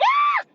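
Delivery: high shrill scream; a shocked high shriek; piercing startled shriek; frozen scream. Retold or imitated. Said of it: "I froze, and I was just like".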